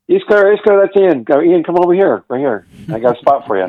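high, loud, eager call across the set; excited calling out. Retold or imitated. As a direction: wave him over, thrilled to see him. Director calls him over on set.

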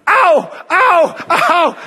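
high, loud mock pain cries of ow. Girl with the broken leg walks without crutches, mock pain cries. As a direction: imitate a girl yelping in pain on each step. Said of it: "So we run and go".

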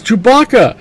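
high, loud cry of the name; alarmed shout. Retold or imitated. Leia seeing Chewie burning, a high loud alarmed cry. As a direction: scream his name in alarm. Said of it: "And Leia's".

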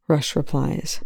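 usual speaking voice

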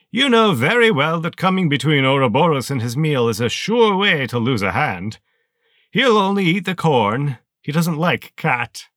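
high, loud, sneering villain voice; towering armored colonel greeting a lieutenant, high and loud; menacing colonel. Acted. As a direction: let it cut like a rusty saw blade. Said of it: "like a rusty saw blade slicing through your spine".

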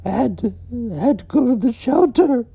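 shrieking high and loud, voice cracking; screaming breakdown. Acted. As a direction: wail it out, falling apart. Breakdown yelling over the story.

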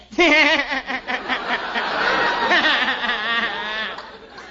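loud laughing fit mid-scene; can't hold it together, laughing; breaking up laughing. Real, not acted. Guest cracks up in front of Sandra, laughing.

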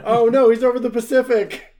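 high, loud mock panic; mock alarm. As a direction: cry out in fake alarm. Mock alarm tracking Hugo.

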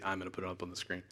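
a muttered echo of himself, gently teasing; mock quiet self-quote. Preacher quietly mocking his own slip about the screen. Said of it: "I say".

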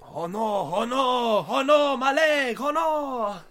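wailing in a cartoon French accent, slow on the leg line; over-the-top French accent yelp; mock French accent. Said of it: "that was seriously racist".

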